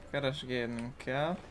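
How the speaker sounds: ordinary delivery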